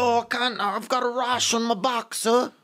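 high pleading Cockney beggar voice; Cockney street urchin. Retold or imitated. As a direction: whine like a poor London street kid. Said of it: "more like, street".

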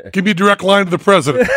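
hamming up her only English phrase; mock wife's stiff English. [name] mimics his friend's wife demanding a direct line, laughing. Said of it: "So his wife can say".

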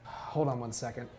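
ordinary talk

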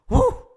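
high, loud whoop; sudden piercing whoo. Acted. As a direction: let out a wild drunken whoop. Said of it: "And then he goes".

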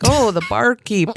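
half-hearted go at an Irish voice; Irish accent. Retold or imitated. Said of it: "accents and vo".

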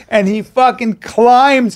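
ordinary delivery

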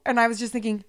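ordinary talk

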